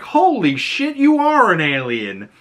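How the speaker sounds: high shocked exclaim. Retold or imitated. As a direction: blurt it out in disbelief.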